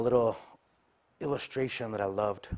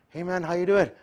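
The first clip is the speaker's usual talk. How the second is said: friendly greeting; 8 semitones higher, 7 dB louder than usual. Retold or imitated. Brother greets at church.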